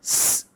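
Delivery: "S" as a long hard sibilant; sustained, forceful s sound; strong hiss. Shown as a demonstration. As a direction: hiss the letter "S" steadily. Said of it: "desisnya begitu keras".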